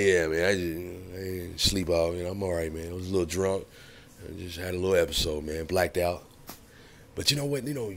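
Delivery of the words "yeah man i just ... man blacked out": imitated hungover friend. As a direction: sound like you're still recovering from a blackout. Friend's hungover excuse voiced.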